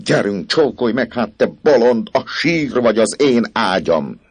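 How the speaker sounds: raging, rising to a loud, high shout; furious priest. Acted. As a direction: threaten her, climbing to a frenzied roar. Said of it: "A pap dühötten ragadta karjába". (dühöngő, egyre hangosabban kiabáló pap)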